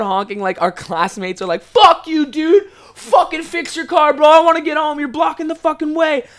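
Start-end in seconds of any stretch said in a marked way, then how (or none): 1.6-6.3 high, loud mock teen fury; hammed-up frustrated yelling; imitated angry yell. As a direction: shout like a classmate stuck behind the truck. Classmates yelling at stalled truck.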